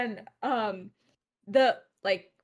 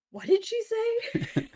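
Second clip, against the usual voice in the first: high, slow puzzled parent voice; confused parent; 10 semitones higher, slower than usual. Retold or imitated. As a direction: ask it slowly, lost and baffled.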